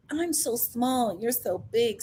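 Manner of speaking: slow, soft mock-girlish simper; performing smallness. Host imitating Francesca performing for Joseph, mock-coy. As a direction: play tiny and impressed, softly. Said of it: "the, like".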